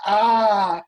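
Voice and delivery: loud, high drawn-out "ah"; hammed-up wail of frustration; mock anguished scream. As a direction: cry out in exaggerated despair. Retold reaction to failed inspection.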